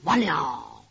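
very low, drawn-out sigh of doom; sinking, resigned low aside; despairing inner thought. A narrator's reading. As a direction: groan it inwardly, pitched right down. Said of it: "心想".

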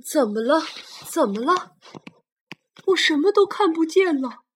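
slow, loud, strained cries of pain; hurt dinosaur groaning. Acted. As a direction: stammer it out like a wounded beast. Injured blind T-rex cries out.

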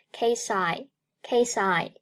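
"case I" joined, s carried onto "I"; linked pronunciation. Shown as a demonstration. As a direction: slowly link "case I" as one word. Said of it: "Case和I连读".

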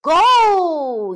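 long, high starting yell; loud drawn-out storybook shout. A narrator's reading. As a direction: yell the start of a race, stretching the word. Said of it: "yelled Gary".

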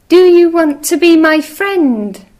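high, loud eager little mouse. Acted. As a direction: call out brightly like a tiny mouse. Mouse asks each animal.

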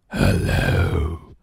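slow, growled monster hello; deep scary monster. Acted. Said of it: "growls a monster in a deep, scary voice".

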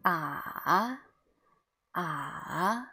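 long "Ah" dipping down, then rising up; "Ah" in a slow valley-shaped glide; third tone. Shown as a demonstration. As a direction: let "Ah" fall and then swoop upward. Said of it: "go down and then go up".

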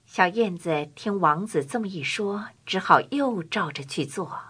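usual speaking voice

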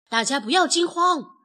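loud, firm call to a panicking crowd; shout. A narrator's reading. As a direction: call out to steady everyone. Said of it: "大喊道". (大声喊话稳住大家)

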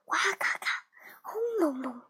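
soft, hushed thunder noises; imitated thunder. A narrator's reading. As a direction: imitate distant thunder, very softly. Narrator imitates thunder in storm.

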